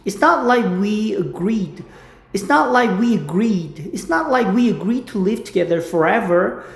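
very slow, careful English line; slow drill. Shown as a demonstration. Teacher drills the line at half speed. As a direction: say it slowly word by word. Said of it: "slow repetition drill".

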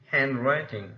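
"handwriting" with HAND leading, writing lighter; first-syllable stress. Shown as a demonstration. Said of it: "the first syllable is stressed".

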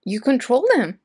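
loud, high shocked exclamation; panicking gamer. Real, not acted. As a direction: blurt it out in disbelief. Reacting to a reveal.

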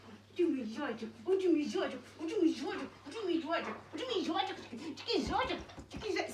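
affectionate cooing. Real, not acted. Owner fussing over her little bunny of a dog, cooing. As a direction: gush over a beloved dog in a rising singsong.

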